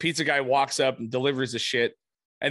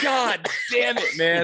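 mock despair; 7 semitones higher, slower than usual. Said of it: "I'm like".

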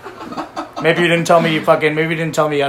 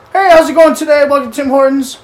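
chirpy worker; 8 semitones higher, 6 dB louder than usual. Retold or imitated. Voicing the drive-thru worker.

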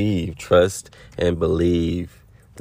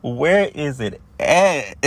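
impatient fans; 6 semitones higher, much slower than usual. Retold or imitated. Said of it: "And I know y'all just like".

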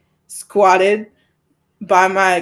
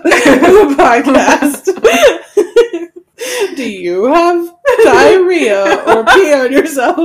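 higher, louder ad read breaking into laughter; laughing mock commercial; 4 semitones higher, 6 dB louder than usual. Co-host plugging podcast to sufferers, laughing mock commercial. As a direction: try the infomercial pitch while cracking up.

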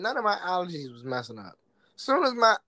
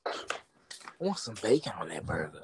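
whispered craving for bacon; whispering; 9 semitones lower, 7 dB quieter than usual. Real, not acted. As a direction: whisper it hungrily.

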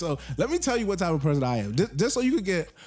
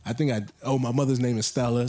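ordinary talk, nothing marked

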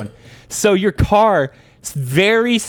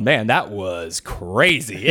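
lower, slow, stunned drawl; dazed friend; 9 semitones lower, 3 dB quieter, much slower than usual. Retold or imitated. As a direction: play the friend still rattled from the ride. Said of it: "Jeff was going".

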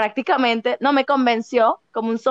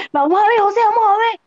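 higher, louder eager insistence; 8 semitones higher, 3 dB louder, much faster than usual. Retold or imitated. [name] urging José to go watch the fight, eager. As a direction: push to go look, nosy and keen. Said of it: "Y yo".

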